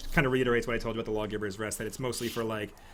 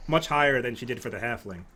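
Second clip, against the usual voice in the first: ordinary delivery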